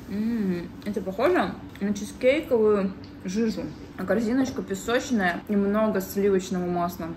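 speaking between bites of pastry; talking while chewing. Real, not acted. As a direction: mouth partly full of tart. Seen 2: Bites tart at -1 and 9.5, chews while talking.

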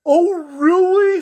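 high, loud, slow clown voice; the clown lights up with hope; hopeful clown. Acted. Clown answers the girl's promise, high hopeful clown voice. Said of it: "said the clown".

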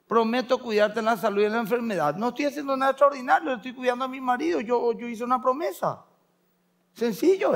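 high, quick, emphatic protest; imitated insistent mother. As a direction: play a mother brushing off praise, high and brisk. Mother insists she keeps her promise. (madre imitada, aguda, rápida y categórica)

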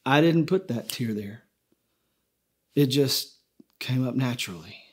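God quietly explaining the tear, somberly. Acted. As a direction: let the weight of it settle. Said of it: "And then somberly".

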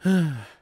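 loud exasperated sigh; annoyed sigh. Acted. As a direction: huff out the annoyance. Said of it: "annoyed sigh".

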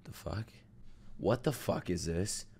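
breathy, disbelieving whisper; hushed shock under the breath; stunned whisper. Real, not acted. Stunned by the anime opening.

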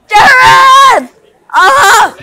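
real break on a shouted name; voice cracking shout. Real, not acted. Boy yells a friend's name, his voice cracking. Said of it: "Okay, voice cracking!".

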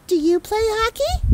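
sweetly fish for information like a sly mom; high, pointed mom voice; mom's voice. Acted. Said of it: "She pointed to the tallest teen and said".

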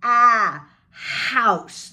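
loud AH leading into a stretched house; pinched "ah" into house. Shown as a demonstration. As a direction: cry AH, then open "house" just as wide. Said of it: "pinching you".

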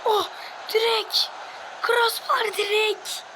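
high, loud, stretched-out cry at the crossbar; excited shout. Real, not acted. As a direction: yell it as the ball hits the bar. Shot hits the crossbar.

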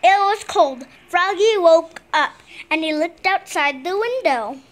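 ordinary delivery, nothing marked

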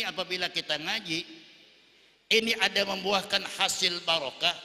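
loud, high preaching from the pulpit; fiery preaching. Acted. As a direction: preach it out at full force. Preacher raises to full force.

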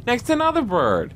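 high-pitched recital of a poem; poem reading. Acted. Reading the bird riddle after demanding a picture, high recital.

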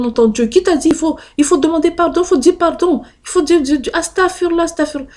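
high, loud alarmed mother's scolding; imitating mother shouting. Mother screaming that the shaitan speaks to her daughter, high and loud. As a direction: shriek at her like a frightened mother. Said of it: "elle a commencé à crier".

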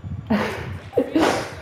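airy wheezing laugh; breathy laugh. Real, not acted. Laughs after voice crack.

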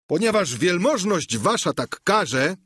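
high-pitched, eager servant voice; Sancho. A narrator's reading. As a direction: play the squire pitched well above the narration. (wysoki głos giermka)

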